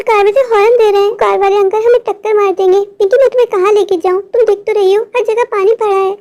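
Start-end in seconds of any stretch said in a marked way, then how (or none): none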